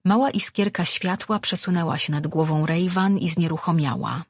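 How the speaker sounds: plain reading voice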